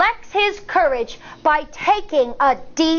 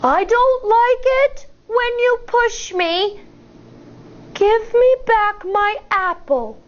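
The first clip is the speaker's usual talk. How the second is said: higher, bold standing-tall voice; big voice; 6 semitones higher than usual. Acted. Firefly confronting the bully in his big voice. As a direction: plant your feet and say it boldly. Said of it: "says in his big voice".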